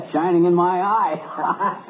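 slow words through laughter; laughing. Acted. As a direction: laugh while you say it. Laughing at his own joke.

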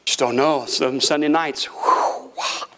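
low-pitched, hesitant grumbler; doubtful churchgoer. Acted. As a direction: voice a member with misgivings, pitched well down. Mimics doubtful church member.

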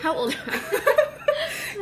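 asked through real laughter; laughing. Real, not acted. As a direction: giggle the question out, high and playful. Teasing laugh about her age.

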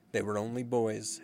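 usual speaking voice

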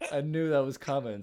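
ordinary talk, nothing marked

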